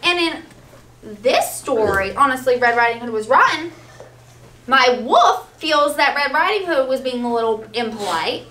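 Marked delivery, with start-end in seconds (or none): none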